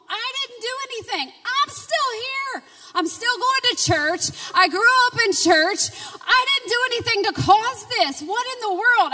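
high, strained, near tears; despairing inner cry. Retold or imitated. Remembering her own despair at home, in a high strained cry. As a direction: let it crack toward breaking.

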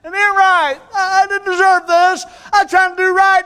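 high, whining complaint about a boo-boo; mock self-pity, high and far from his own voice; whiny hurt believer. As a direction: whimper it like a wounded child. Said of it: "because you say".

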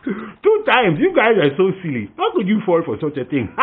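high, loud taunting through laughs; mocking laughter. Boy laughing at the villagers fooled twice, mocking. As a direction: laugh at how silly they are.

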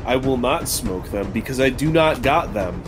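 normal talking voice

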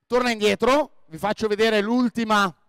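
ordinary talk, nothing marked

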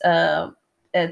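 usual speaking voice

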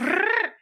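trilled rolled R sound. Shown as a demonstration. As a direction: roll the Rrr with the tongue tip. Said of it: "no need to show off there".

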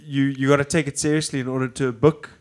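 usual speaking voice

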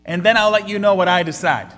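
arrogant challenge. Acted. Voicing a doubter challenging God.

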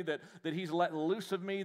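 usual speaking voice

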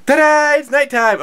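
high, loud playful announcement; mock ta-da reveal. Vlogger reveals that it's nighttime, playful fanfare.

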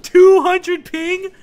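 drawn-out, high and loud disbelief; incredulous. Real, not acted. Streamer repeating the ping to viewers, slow and stunned. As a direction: stretch it out slowly like you can't believe it.

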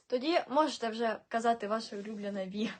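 ordinary talk, nothing marked

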